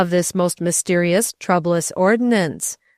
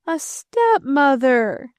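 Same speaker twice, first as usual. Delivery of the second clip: put-on slow, higher, groaning boy; groaned; 7 semitones higher, slower than usual. A narrator's reading. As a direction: groan out a stepmother in misery. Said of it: "the boy groaned".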